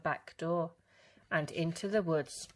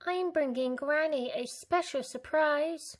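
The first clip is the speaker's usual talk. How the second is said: put-on little girl; 10 semitones higher, 4 dB louder than usual. A narrator's reading. Little Red's voice.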